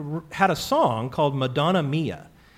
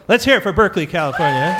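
louder and higher, rallying the crowd; cheering call; 4 semitones higher, 5 dB louder, faster than usual. Real, not acted. Host to audience, rallying applause for Berkeley, loud cheering call. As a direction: whip up applause for the hometown.

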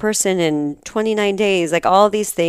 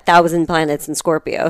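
ordinary talk

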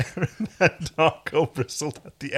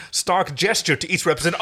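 ordinary delivery

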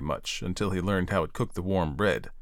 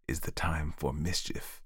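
soft, private gloating; hushed thought with a touch of a grin; grinning to himself; 3 semitones lower, 7 dB quieter than usual. A narrator's reading. Nephew thinks to himself of mischief, quietly gleeful. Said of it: "he thought, grinning to himself".

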